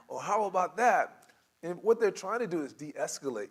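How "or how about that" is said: slow, higher helpful suggestion; patient, lifted counter-offer; positive spin. Acted. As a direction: agent brightly offering an alternative. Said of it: "putting a very positive spin on".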